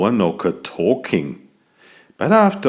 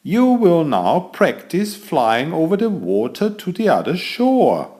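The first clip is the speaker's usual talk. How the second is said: master wizard; 6 semitones higher than usual. Acted. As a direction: welcome the class like a proud old wizard. Wizard teaches flying spell.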